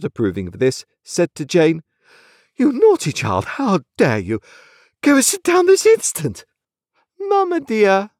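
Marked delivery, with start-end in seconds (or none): 2.6-6.4 high, loud scolding; outraged high-pitched reprimand; indignant mother. A narrator's reading. Mother scolds little Jane. 7.2-8.1 put-on high, childlike lisp; tiny lisping child's voice, pitched high; lisping little girl. A narrator's reading. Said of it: "lisped the little girl".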